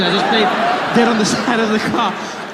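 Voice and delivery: ordinary talk, nothing marked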